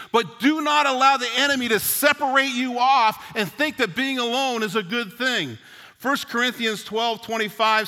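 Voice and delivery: loud, high fired-up preaching. Acted. Pastor urging the church not to isolate, fired up. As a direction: preach it out to the congregation.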